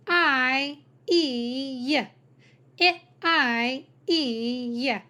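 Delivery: "I, E, y" each sound alone, the middle ones held; separate vowel sounds. Shown as a demonstration. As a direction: drill "I, E, y" as isolated sounds. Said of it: "teaching you to sound out words".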